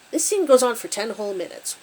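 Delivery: ordinary delivery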